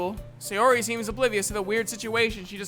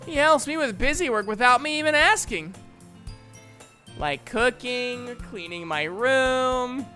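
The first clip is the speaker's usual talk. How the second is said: higher, lively reassuring voice; cheerful friend; 4 semitones higher, 3 dB louder than usual. Acted. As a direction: chirp it happily, stretching out the last items. Cheerful friend praising Hayate.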